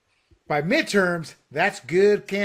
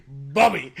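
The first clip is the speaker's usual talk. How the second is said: Theo Von; 3 semitones lower, 5 dB louder than usual. Retold or imitated.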